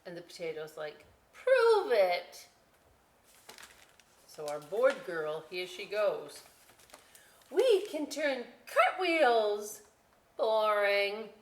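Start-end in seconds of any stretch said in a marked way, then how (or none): 1.3-2.5 much higher, louder dare; challenging potato. Acted. 7.5-9.8 much higher, slow keen voice; eager girl. Acted. 10.4-11.3 bored dismissal. Acted. Potato dismisses.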